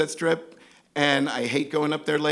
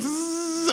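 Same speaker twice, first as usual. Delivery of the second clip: high buzzing "zzz"; sustained electric whirr made by mouth; imitated window motor; 12 semitones higher than usual. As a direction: buzz like power windows sliding down. Imitating car windows going down.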